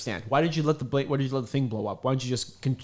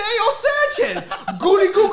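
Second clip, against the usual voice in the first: much higher laughing put-on voice; laughing high squeal; 5 dB louder than usual. Retelling the Eddie Murphy bit, laughing high squeal. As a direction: squeal the greeting through laughs. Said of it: "I said".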